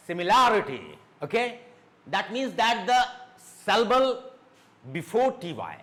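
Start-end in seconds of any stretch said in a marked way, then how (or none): 0.1-1.0 similarity with LA raised and strong, then falling; stress on LA. Shown as a demonstration. Teacher drilling words ending in T Y, stress on LA. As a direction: punch the LA in similarity. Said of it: "a raise of your voice".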